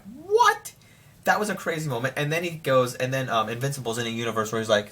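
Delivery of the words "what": squeaky high shocked "What?"; high-pitched gasp of disbelief; stunned what. Retold or imitated. Stunned reaction to surprise cameo.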